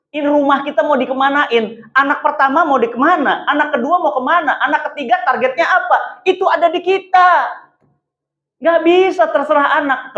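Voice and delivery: ordinary talk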